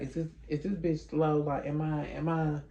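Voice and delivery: said softly, as if to oneself; quiet, puzzled thinking aloud; inner thought. Retold or imitated. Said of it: "I'm thinking in my head".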